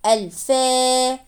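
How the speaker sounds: الفا slowly, the faa held long and level; long alif vowel. Shown as a demonstration. Teacher showing madd after fa, long held ending on الفا. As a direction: stretch the final vowel of الفا. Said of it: "مد بالألف متصل مع الفاء المفتوحة".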